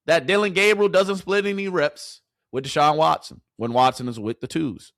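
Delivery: ordinary talk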